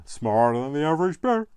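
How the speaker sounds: hammed-up Yogi Bear impression. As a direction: boom it out like a jolly cartoon bear. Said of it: "suddenly sounded like, um, Yogi Bear".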